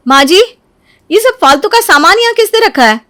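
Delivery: high, loud indignant demand; annoyed. Acted. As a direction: demand an explanation crossly. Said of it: "बड़ी गुस्सा होती है". (गुस्से भरी ऊँची आवाज़ में)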